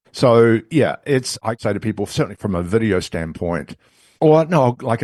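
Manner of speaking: usual speaking voice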